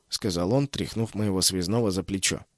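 plain reading voice

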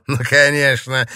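mocking and snide. A narrator's reading. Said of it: "Эмиль ехидно улыбнулся".

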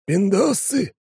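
ordinary delivery, nothing marked

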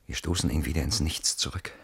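usual speaking voice

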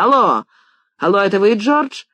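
high, loud telephone hello; feverish hurry. A narrator's reading. American writer calls George on the phone, feverishly eager. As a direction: call eagerly down the line, voice leaping up. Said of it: "лихорадочная речь".

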